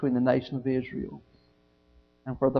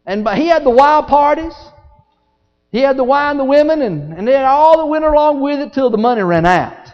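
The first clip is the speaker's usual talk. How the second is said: high, loud, driving sermon; full-force preaching; 12 semitones higher, 11 dB louder than usual. Real, not acted.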